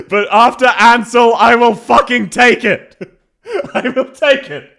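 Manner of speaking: loud, slow, high exclaiming; real relieved outburst. Real, not acted. Streamer relieved after Ansel's awful accent, loud and exasperated. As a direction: blurt the relief loudly.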